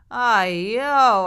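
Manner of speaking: loud ay yo cry; exasperated cry. Acted. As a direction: throw up your hands and cry out. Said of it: "I cried".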